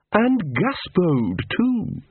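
lightly voiced high menacing poodle, slow and suspicious; a small dog leader sneering at a spy, drawn out; Big Fido the poodle. Poodle gang boss to Angua, slow and menacing. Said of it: "said the poodle".

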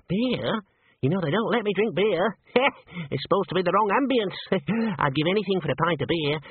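high squeaky man with giggles. A narrator's reading. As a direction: squeak longingly, little laughs between phrases. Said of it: "in a squeaky voice".

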